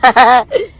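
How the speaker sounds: short loud laugh; laughter. Real, not acted. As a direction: burst out laughing at the slip. Laughing over her own mispronunciation.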